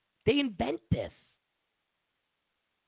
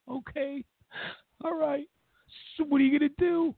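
much higher, doubtful questioning wife; 11 semitones higher than usual. Pretend. Wife questioning her cheating husband, high and skeptical. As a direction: play the skeptical wife asking what now.

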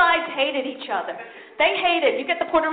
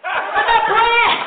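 loud, high shout, second slower; answering the crowd with a loud shout; punchline shout; 9 semitones higher, 10 dB louder, slower than usual. Real, not acted. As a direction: bellow the answer. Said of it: "Do you know why".

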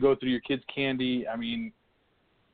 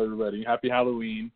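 normal talking voice

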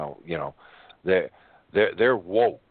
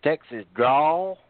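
Texas drawl; 5 semitones higher, 4 dB louder, slower than usual. Retold or imitated. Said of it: "picking on the Texans".

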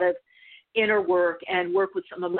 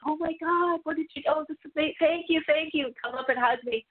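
much higher, gushing mock amazement; imitating grateful bystanders, higher; amazed onlookers; 7 semitones higher than usual. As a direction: gush with thanks. Onlookers amazed after the standoff.

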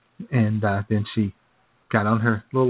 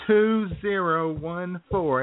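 very slow, higher, each number drawn out; slow typing out; 7 semitones higher, much slower than usual. Retold or imitated. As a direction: read the numbers like slowly typing them. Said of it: "she says".

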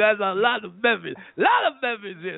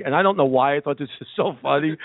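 ordinary talk, nothing marked

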